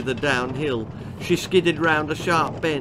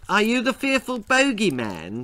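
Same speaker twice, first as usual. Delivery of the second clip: quivering; 7 semitones higher than usual. Acted. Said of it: "she quivered".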